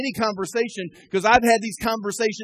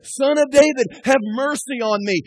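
calling out louder, pleading; crying out; 5 semitones higher, 5 dB louder than usual. Retold or imitated. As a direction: beg for Jesus's attention. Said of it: "he just keeps saying".

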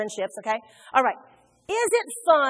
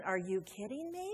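quiet disbelief; 3 semitones lower, 12 dB quieter than usual. Acted. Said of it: "And he's going".

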